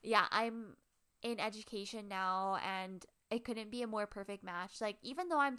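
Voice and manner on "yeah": ordinary talk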